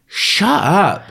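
high, drawn-out "enough of you" tone; mock telling-off of a character; exasperated retort.

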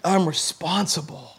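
low, slow and soft; sobered realisation. Retold or imitated. Young man realising he pays his own insurance, sobered. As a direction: let the weight land quietly.

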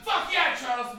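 high, loud whoop; excited fan cheer. Retold or imitated. Teen fan hails Charles Bukowski, high, loud whoop. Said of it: "I've gone from".

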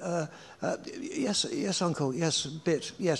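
put-on quiet, halting little-boy stammer; timid stammer. Eight-year-old answering his intimidating uncle, quiet and stammering. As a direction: stumble over it softly like a scared kid.

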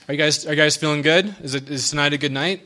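ordinary talk

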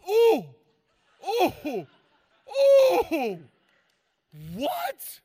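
high, loud mock gasps of shock, rising each time; shocked yelps. Golfer caught cussing in front of the pastor, shocked high yelps.

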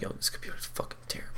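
fast, whispered grumble under the breath; under her breath. Retold or imitated. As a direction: mutter it fast to yourself, barely voiced. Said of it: "talking under her breath".

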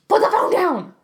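exasperated shout. Retold or imitated. Ranting at the driver.

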